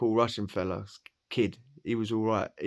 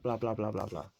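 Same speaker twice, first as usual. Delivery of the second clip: ordinary talk, nothing marked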